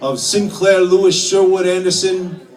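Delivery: ordinary talk, nothing marked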